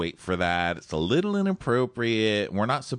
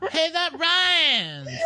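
very high, slow delighted squeal; 4 dB louder, much slower than usual. Pretend. Mock amazed high squeal.

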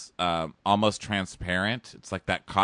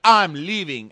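mock huff; 7 semitones higher, 8 dB louder than usual. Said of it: "he's like".

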